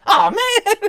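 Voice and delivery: high, loud comic "aw man"; disappointed groan. Retold or imitated. Guard realizing why he is there, high mock dismay. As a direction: deflate like a caught kid. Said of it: "this is his reaction".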